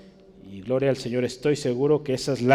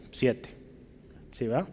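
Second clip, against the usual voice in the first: ordinary talk, nothing marked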